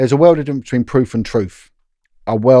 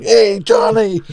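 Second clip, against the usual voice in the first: high, raised-voice playful taunt; rowdy greeting; 8 semitones higher, 4 dB louder, much slower than usual. Retold or imitated. Vinny teasing John in a headlock, rowdy greeting. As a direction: call it out high and boisterous.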